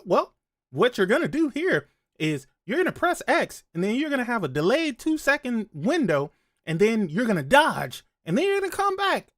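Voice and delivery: high, fast chirpy helper voice; cheerful assistant explaining controls. Retold or imitated. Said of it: "gonna be able to answer and say".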